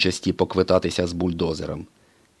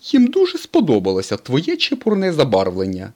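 higher, louder teasing tow truck; Mater teasing; 8 semitones higher, 6 dB louder than usual. A narrator's reading. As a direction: tease the race car about his paint. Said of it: "сказав блискавці Сирник".

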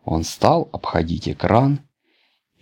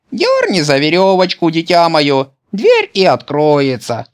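a kindly grandmother calling through the door; granny voice; 10 semitones higher, 4 dB louder than usual. A narrator's reading. (бабушка ласково отвечает, высоким старческим голосом)

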